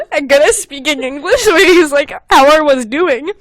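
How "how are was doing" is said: put-on German accent. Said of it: "like, is German, but he's not really good".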